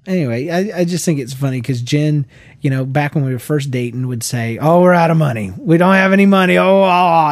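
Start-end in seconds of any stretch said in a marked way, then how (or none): 4.6-7.3 loud, fast, panicked wife imitation; mock panic. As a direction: ham up her money panic. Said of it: "and she's freaking out".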